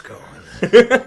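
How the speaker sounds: slow, laughing joke. Pretend. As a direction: laugh through a slow line. Joking about voice budget.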